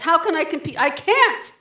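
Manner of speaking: high, loud, anguished; anguished cry. Acted. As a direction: break into despair. Despair, voice climbs.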